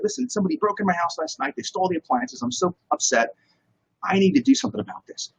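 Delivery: mildly upset, imitating yourself; mock upset. Acts out his upset plea to friend.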